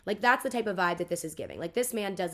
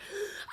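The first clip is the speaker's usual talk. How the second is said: sharp theatrical gasp; big intake before an acted scream; dramatic gasp; 6 dB quieter than usual. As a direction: gasp hard, winding up to yell. Winds up for quoted outburst.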